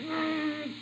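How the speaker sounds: high, soft mock growl; little high-pitched territorial "grrr"; imitated butterfly growl. Butterfly warns off another butterfly, mock growl.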